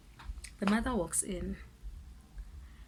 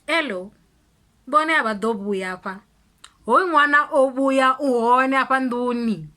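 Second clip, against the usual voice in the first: loud, higher calling into the house, slowed at first; a mother hollering for the kids; hammed-up mother shouting from the yard; 3 semitones higher, 8 dB louder than usual. Mother calls for child inside.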